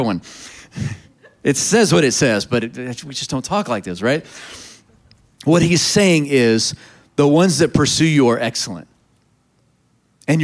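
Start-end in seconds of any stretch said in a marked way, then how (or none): none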